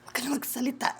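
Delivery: whispered hush; soft whispered shush. Real, not acted. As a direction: whisper to quiet her down.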